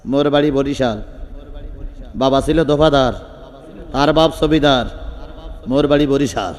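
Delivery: low, measured boasting in short phrases; low voice announcing who he is, with pauses; Omar. Acted. Preacher playing a man introducing himself to an opponent, low boast. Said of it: "এবার হযরত ওমর বললেন".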